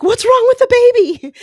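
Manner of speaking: high, loud imitation of a panicked husband; loud alarmed shout. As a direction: shout it in mock alarm down the phone. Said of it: "that was a little loud".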